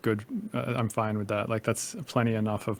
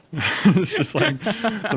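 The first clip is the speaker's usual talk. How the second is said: laughing; 10 semitones higher, 5 dB louder, much slower than usual. Real, not acted. Laughing at own impression.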